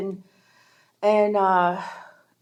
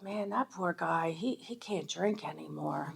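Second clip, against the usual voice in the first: much softer, hushed pity to yourself; pitying thought; 3 semitones lower, 14 dB quieter than usual. Retold or imitated. Thinking about the sober boss, softly pitying. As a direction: murmur it like a private thought.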